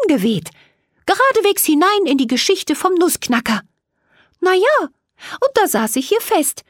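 a small fairy explaining her trouble and asking for help; little fairy Millie. A narrator's reading. Fairy Millie pleads with the two girls for help.

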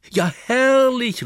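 hoch, langsam gedehnt vor Freude; begeisterter Ruf. Acted. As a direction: jubelt über die Idee. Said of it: "ruft Moritz". (Moritz freut sich auf Lösegeld, begeistert)

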